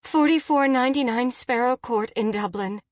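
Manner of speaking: high, tense, hurried-sounding answer; worried mother on an emergency call; urgent reply. A narrator's reading. Frantic mother gives dispatcher address.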